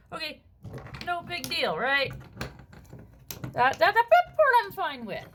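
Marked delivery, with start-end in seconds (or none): 3.5-5.3 louder, pushing the words out; emphatic. Real, not acted. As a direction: stress the part he accepts. Emphatic grudging acceptance.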